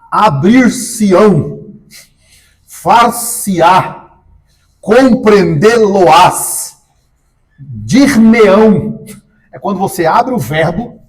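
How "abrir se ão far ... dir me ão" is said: each verb split slowly, pronoun set clearly in the middle; demonstrating mesoclisis. Shown as a demonstration. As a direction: slowly spell out abrir-se-ão, far-se-á, compreendê-lo-ás, dir-me-ão. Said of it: "É quando eu coloco o pronome no meio do verbo". (exemplos de mesóclise ditos devagar e com ênfase)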